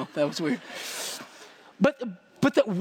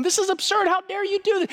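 high, incredulous outrage; Paul can't believe the church does this, indignant; 10 semitones higher, faster than usual. Retold or imitated. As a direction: voice Paul's exasperated disbelief. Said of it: "Paul's going".

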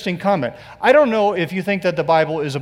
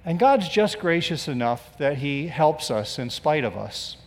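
usual speaking voice

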